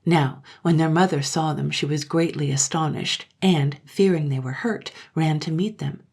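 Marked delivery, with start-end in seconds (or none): none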